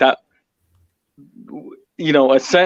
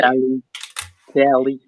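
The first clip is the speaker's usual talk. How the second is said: badly imitated Californian accent; mock California accent. Guest echoing the streamer's home state, bad California accent. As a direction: try saying it like a Californian. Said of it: "My accent's terrible as well".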